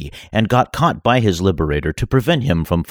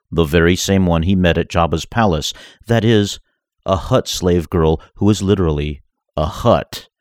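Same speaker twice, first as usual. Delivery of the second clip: ordinary delivery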